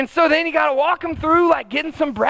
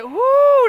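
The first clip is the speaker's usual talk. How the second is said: whoo of dread; 12 semitones higher, 5 dB louder than usual. Retold or imitated. Dreading the first school day.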